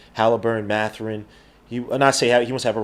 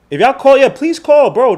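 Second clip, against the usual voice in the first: loud, high begging for callers; pleading call-out; 12 semitones higher, 8 dB louder than usual. Real, not acted. Streamer loudly begging viewers to phone in, high and pleading. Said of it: "begging viewers to call in".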